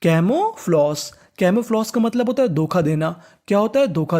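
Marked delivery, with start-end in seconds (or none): 0.0-1.1 slow drawn-out. Shown as a demonstration.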